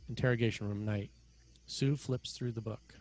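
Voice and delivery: normal talking voice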